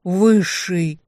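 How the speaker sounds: loud terrified scream; scream of horror. Acted. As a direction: scream it in terror. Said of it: "единственный вопль ужаса".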